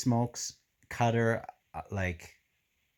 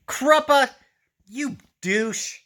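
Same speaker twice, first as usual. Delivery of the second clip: much higher, louder exasperated yelp; mock outrage; 8 dB louder, slower than usual. Real, not acted. Reader scolding Kruppa for his hint, mock-outraged yelp. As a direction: scold the character in playful outrage. Annoyed at a book character.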